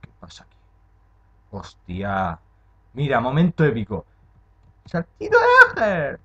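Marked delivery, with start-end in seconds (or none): 4.8-6.2 triumphant call. Real, not acted. Gamer calling out her big jump, shouting in triumph. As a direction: announce the move like a victory shout.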